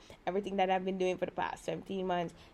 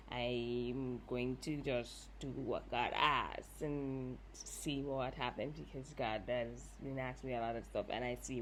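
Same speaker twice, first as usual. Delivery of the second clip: quiet resolve; 7 semitones lower, 6 dB quieter than usual. Real, not acted. Speaking of obedience to God, low and soft. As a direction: settle into quiet surrender.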